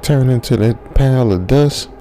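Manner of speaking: ordinary delivery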